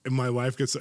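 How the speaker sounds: ordinary talk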